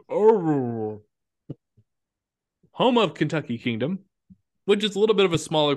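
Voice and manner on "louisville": Louisville squashed to a thick OVL; Louisville swallowed as if through peanut butter; mouth full of peanut butter. Shown as a demonstration. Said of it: "You guys say it like your mouth's full of peanut butter".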